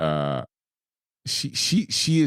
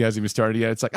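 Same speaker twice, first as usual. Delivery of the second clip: ordinary talk, nothing marked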